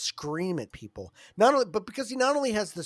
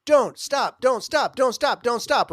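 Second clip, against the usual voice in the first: high, loud, words run together into a plea; running-together Don't stop; 5 semitones higher, 7 dB louder, slower than usual. Retold or imitated. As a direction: flip the phrase into a plea. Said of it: "it turns into something else".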